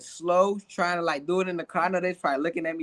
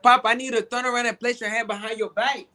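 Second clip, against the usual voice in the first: quick, higher Spanish-accented English; put-on Spanish accent; 4 semitones higher, faster than usual. Shown as a demonstration. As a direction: rattle off the order in a mock Spanish accent. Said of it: "if I can do it real quick".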